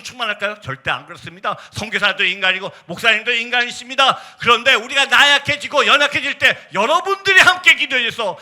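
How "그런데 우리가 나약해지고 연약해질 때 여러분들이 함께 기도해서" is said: loud, high climactic exhortation; 열정적인 설교. Real, not acted. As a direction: build to a fervent, shouted preaching climax. Preaching climax. (절정으로 치닫는 힘찬 설교)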